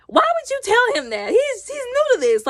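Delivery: high-pitched, loud indignant protest; exasperated protest. Retold or imitated. Reacting in protest to the story.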